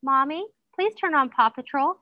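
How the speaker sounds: high, sweet little plea; sweetly asking child. Acted. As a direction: imitate a child asking mommy nicely. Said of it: "if he says sweetly".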